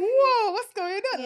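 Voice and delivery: high, loud startled whoa. Retold or imitated. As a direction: play it totally startled.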